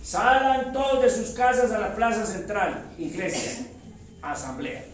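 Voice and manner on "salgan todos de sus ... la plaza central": pregón alto y agudo a la plaza; llama a todos a salir de casa; heraldo llamando. Acted. (pregón de heraldo en voz alta)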